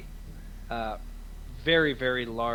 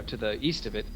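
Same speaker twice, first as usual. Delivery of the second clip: normal talking voice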